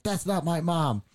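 high, drawn-out mock protest; indignant denial. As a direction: deny it slowly, with retold indignation. Retold indignant denial to schoolmate.

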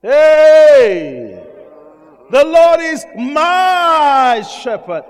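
loud burst, then slow drawn-out proclamation; sudden shout. Real, not acted. Preacher bursting out to the church, loud and slow. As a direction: cry out, then stretch every word.

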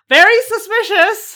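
very suspicious. Acted. Co-host gleeful mock suspicion.

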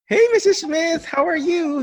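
very high, loud, sing-out hello; jolly caregiver greeting. Shown as a demonstration. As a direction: greet the patient with over-the-top cheer. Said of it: "you come in with".

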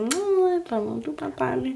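airy, breathy imitation of her cooing; mock sweet voice. Imitating the woman cooing at her man, mock sweet. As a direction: coo it softly like a lovesick woman. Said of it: "hvor hun var sådan".

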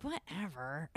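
dismissive. Retold or imitated. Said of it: "And I was like".

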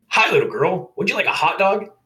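high, loud, chirpy vendor voice; very cheerful vendor. A narrator's reading. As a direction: greet a customer with sing-out cheer. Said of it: "in a very cheerful voice".

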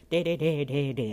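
loud droned filler syllables; mock an official rattling on; serious clerk. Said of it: "And she was so serious".

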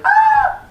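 sudden high-pitched "Ah!"; high yelp. Acted. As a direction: let out a startled high cry. Acting out being frozen in fear.

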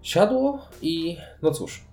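ordinary talk, nothing marked

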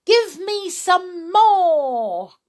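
high, slow, loud demand; eager cry. A narrator's reading. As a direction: stretch out an excited demand. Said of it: "she cried".

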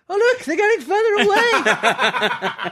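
high, slow, laughing mock voice; playful high-pitched Alice. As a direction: squeak it out, amused at her own feet shrinking. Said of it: "and I'm like".

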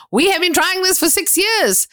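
high-pitched, emphatic disbelief; incredulous protest. Retold or imitated. Applicant to official after six years of trying, incredulous. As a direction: press the point, pitched right up.

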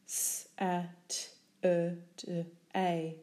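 very slowly sound out "S-A-T-U-R-D-A" one sound at a time; each sound of "S-A-T-U-R-D-A" drawn out separately; sounded out phoneme by phoneme. Shown as a demonstration.

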